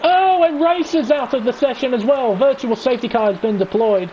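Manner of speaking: excited race call, rising pitch; live play-by-play. Acted. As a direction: call the crash live, urgent.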